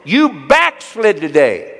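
imitated wife's yell, slow and high; Phyllis. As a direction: yell the accusation loudly, drawing it out. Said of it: "Phyllis yells in a loving voice".

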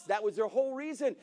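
ordinary talk

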